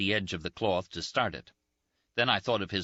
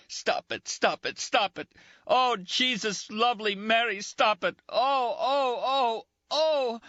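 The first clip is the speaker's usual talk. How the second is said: from pleading to high, loud crying screams; building screams; 4 dB louder, slower than usual. Acted. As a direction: let the pain break into screaming. Dying man screaming in agony.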